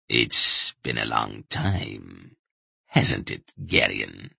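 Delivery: low, slow villain gloating softly; Brill. A narrator's reading. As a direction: drop deep and draw it out menacingly. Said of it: "Brill said quietly, with an ugly chuckle".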